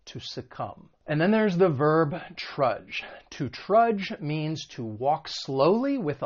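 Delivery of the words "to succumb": "succumb" ending on a plain M, no B; silent B. Shown as a demonstration. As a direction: drop the B at the end of "succumb". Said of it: "there is a silent B".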